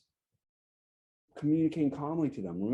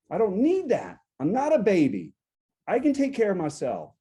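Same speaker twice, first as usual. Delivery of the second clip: louder, higher, emphatic outburst; indignant protest; 6 semitones higher, 6 dB louder than usual. Acted. Coach acting a partner refusing to be babied, indignant. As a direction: protest indignantly, punching it out high and loud. Example of a conflict-starting outburst.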